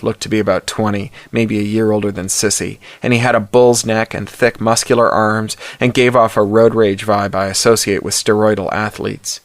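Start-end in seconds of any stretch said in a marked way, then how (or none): none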